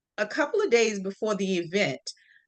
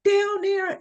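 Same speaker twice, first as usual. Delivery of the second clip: panicked cry; 10 semitones higher than usual. Retold or imitated. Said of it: "And I was like".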